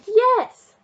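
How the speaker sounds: high, loud cheer of victory; triumphant yes. A narrator's reading. Narrator cheering the winning goal, triumphant shout. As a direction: shout it like you just won. Team wins cup.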